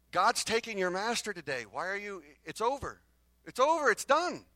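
loud, high and mounting insistence; insistent urging. Acted. Prophets telling Elisha his master is going, insistent. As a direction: press it urgently, pitch climbing at the end.

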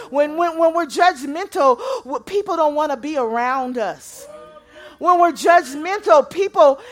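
loud, high, rising sermon delivery; full-voiced revival preaching; preaching at full force. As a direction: preach it out to the whole room, building. Preaching on judgment.

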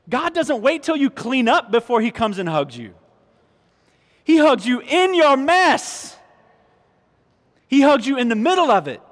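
loud, high, impassioned preaching; full-force preaching. Real, not acted. Preacher to congregation, loud impassioned preaching. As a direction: drive the grace home from the pulpit.

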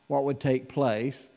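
ordinary delivery

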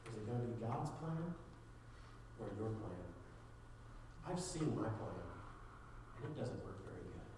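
soft, earnest preaching to the room; hushed sincere altar call; quiet sermon appeal. Real, not acted. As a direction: plead gently with the listeners. Quiet closing appeal to audience.

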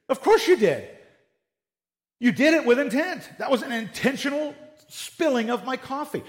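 high-pitched acted outrage; indignant accusation. Coffee owner rejects the excuse of an accident, indignant.

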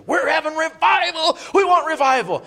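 loud high revival cry; hammed-up pulpit shout; preacher's call. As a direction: proclaim it like a fired-up preacher.

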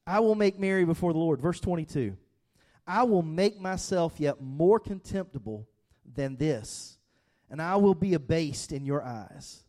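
loud, high preaching voice; preaching at full force. Acted. Pastor reading David's reply to the congregation, fervent preaching. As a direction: proclaim it to the whole room.

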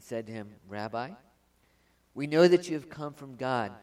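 loud, proclaiming Bible reading; ringing pulpit reading; scripture reading. Acted. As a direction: read the passage out like a preacher to the whole church. Reads the scripture passage aloud.

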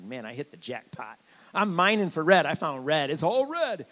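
like striking gold; excited. Retold or imitated. Retold joy at finding red.

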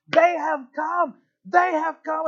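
high, loud, drawn-out cry; triumphant shout. Retold or imitated. Doctor shouting through the prison barracks, jubilant. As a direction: throw off reserve and shout it out. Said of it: "he begins to shout through the prison".

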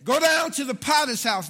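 loud, high, half-sung preacher's shout; preaching at full force. Acted. Pastor rereads the verse to rouse the congregation, shouted and half-sung. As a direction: belt it out in a singing whoop.

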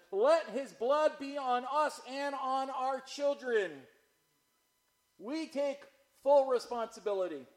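loud, high, emphatic cry; crowd shouting. Acted. All the people answering Pilate, loud shouted declaration. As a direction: shout it out as a defiant mob. Acts out crowd accepting the blame.

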